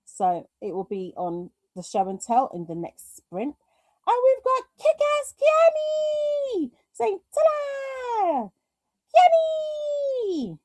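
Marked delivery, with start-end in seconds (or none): 4.1-10.6 high, slow, drawn-out announcer hype; hyped-up announcement. Retold or imitated. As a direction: stretch it out like a big reveal. Hyping a viewer's comment.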